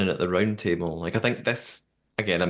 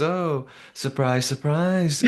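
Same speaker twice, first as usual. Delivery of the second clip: drawn-out, higher-pitched sassy mimicry; 5 semitones higher, slower than usual. Mimicking Joe's catty line for a co-host, sassy. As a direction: imitate a sassy remark, stretching it out slowly. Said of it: "in his sassy way".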